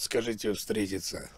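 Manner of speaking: normal talking voice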